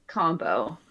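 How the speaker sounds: ordinary delivery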